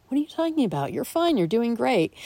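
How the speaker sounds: high, breezy brush-off of someone's worry; mock dismissive voice. People brushing off her worries, high mock reassurance. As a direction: play the people who insist all is fine, pitched well up. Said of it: "the people who are like".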